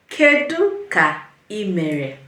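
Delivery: "Kedu ka ị mere" slow, with pauses between words; slow word-by-word model. Shown as a demonstration. Teacher has learners repeat after her, "Kedu ka ị mere" slowly, piece by piece. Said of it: "One more time".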